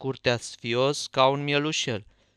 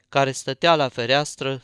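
plain reading voice

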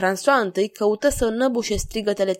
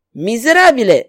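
loud, raised insult; outraged; 4 semitones higher, 8 dB louder than usual. A narrator's reading. King insulted by the stranger, outraged exclamation. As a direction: burst out in outrage. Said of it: "roșii regele".

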